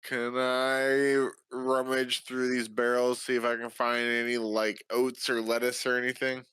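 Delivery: usual speaking voice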